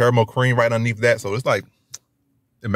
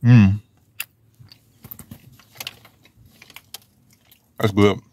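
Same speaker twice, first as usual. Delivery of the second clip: talking while chewing donut; muffled through food; mouth full, chewing; 3 dB louder, slower than usual. Real, not acted. Seen 0: Bites donut at -3s, then visibly chews through the whole stretch.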